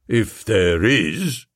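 slow, low stolid constable; doubtful bobby, slowly; london policeman. Acted. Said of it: "said the policeman".

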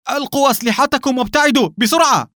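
very high, urgent cry; panicked shout. A narrator's reading. Don ordering his men to drop their weapons, panicked shout. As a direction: yell the order out in alarm. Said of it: "صاح دون ريكاردو في رجاله بفزع".

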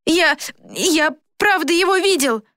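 put-on high, stammering boy protesting; Флорин. A narrator's reading. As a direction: stumble on the first word, then insist in a high voice. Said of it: "заикался Флорин". (заикающийся высокий мальчишеский голос)

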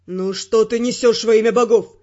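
low, loud male outburst; enraged Ferrant. Acted. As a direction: blow up at her. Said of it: "взъярился Феррант".

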